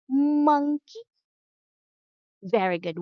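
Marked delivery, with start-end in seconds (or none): 0.1-1.0 "Monkey" higher and louder with a rising tune; model word. Shown as a demonstration. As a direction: say "Monkey" up high for the child to repeat. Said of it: "Can you say".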